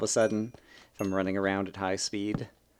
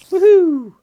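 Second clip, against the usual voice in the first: very high loud whoop; woohoo cheer; 14 dB louder than usual. Real, not acted. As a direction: whoop with joy.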